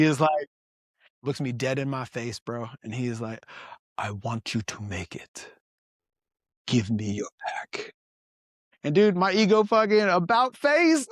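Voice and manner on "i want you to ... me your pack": put-on low, soft Tanzanian-accented plea; earnest porter in a Tanzanian accent, much softer. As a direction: gently insist in a Tanzanian accent, dropping your voice. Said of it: "I apologize for how I'm about to fuck this accent up".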